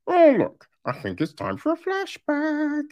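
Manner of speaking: high, sing-song mock of a lazy script; mocking sarcastic quote. Reviewer mocking a lazy flashback, high sarcastic voice. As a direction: send up a cheesy film cue in a high voice.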